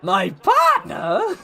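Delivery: loud, drawn-out put-on voice; J H impression. Streamer doing impressions for fun, loud impression. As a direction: belt it slowly in a put-on voice. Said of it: "pretty good J H impression".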